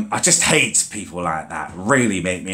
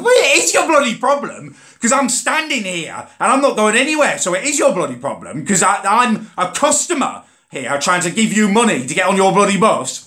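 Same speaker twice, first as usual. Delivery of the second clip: much higher, louder furious rant; retold angry shout; 10 semitones higher, 4 dB louder than usual. Arguing with the driver, mock furious shout. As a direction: yell it back at the driver.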